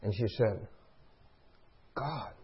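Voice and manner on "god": imitated awestruck murmur, very soft; hushed awe. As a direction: let it drop to a stunned hush. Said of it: "Her anger turned to awe".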